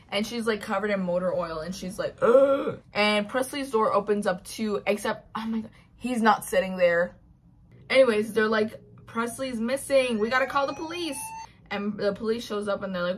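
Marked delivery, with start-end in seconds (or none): 2.2-2.8 loud, higher-pitched "ugh"; acted disgusted groan. Tamsin covered in motor oil, groans. 9.1-11.2 acted alarm.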